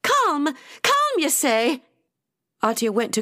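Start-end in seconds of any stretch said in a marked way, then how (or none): 0.0-1.8 high-pitched incredulous retort; outraged disbelief. A narrator's reading. Atia answering Rosie's plea to settle, outraged disbelief. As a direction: throw the word back, high and indignant. Outraged reply to being soothed.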